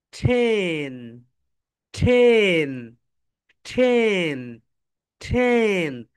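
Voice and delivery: টেন drawn out very slowly; slow drilled টেন. Shown as a demonstration. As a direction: stretch each টেন long for the class. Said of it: "সবাই বলো".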